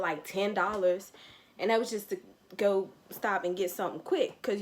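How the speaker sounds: normal talking voice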